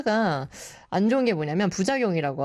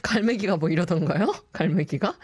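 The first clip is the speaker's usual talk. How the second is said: ordinary talk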